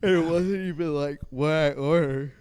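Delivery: talking through a yawn; words stretched by a yawn. Real, not acted. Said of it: "Sorry, I'm yawning so much".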